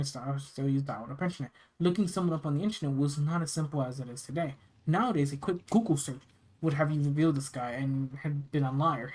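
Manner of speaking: ordinary delivery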